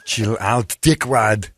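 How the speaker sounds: lightly done Austrian Schwarzenegger accent; a hint of Arnold's Austrian accent. Shown as a demonstration. Host trying the line again in a light Austrian accent. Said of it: "the only way you could say it is in that accent".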